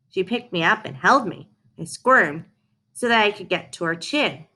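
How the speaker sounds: ordinary delivery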